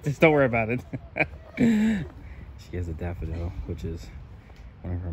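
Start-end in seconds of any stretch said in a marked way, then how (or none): 0.0-2.1 louder, laughing through the words. Real, not acted. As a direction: still cracking up, brushing it off. Still laughing.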